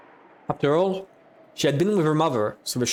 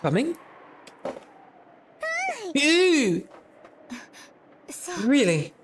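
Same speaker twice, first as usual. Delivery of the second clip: higher, uneasy mother's voice; startled mother; 7 semitones higher than usual. Acted. Mother hearing the witch is coming, higher startled voice. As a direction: react with alarmed disbelief.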